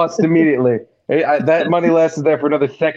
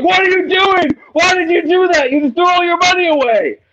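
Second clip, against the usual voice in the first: retold yelling; 12 semitones higher than usual. Table yelling at him over his bet, mock yell. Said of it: "yelling at me".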